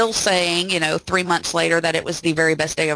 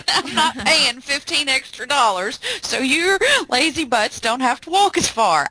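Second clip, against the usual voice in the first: lightly voiced grumpy sitcom dad, higher; Red Foreman impression; 6 semitones higher than usual. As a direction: scold the lazy kids like a gruff TV dad. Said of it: "I hear all the dads sounding like Red Foreman".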